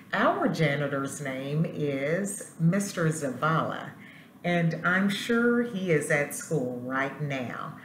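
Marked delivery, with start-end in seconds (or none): none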